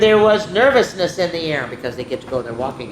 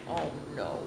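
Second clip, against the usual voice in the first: plain reading voice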